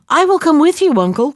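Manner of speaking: put-on eager little boy, starting high; crying out. A narrator's reading. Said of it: "cried out the little boy".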